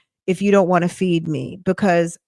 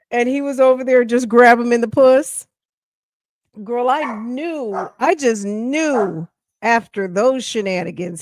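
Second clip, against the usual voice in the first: normal talking voice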